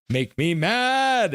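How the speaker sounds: slow, rising pitch on the last word; playful vexed exclamation. Real, not acted. Streamer talking about beating Oilers, drawn-out vexed cry. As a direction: draw it out high.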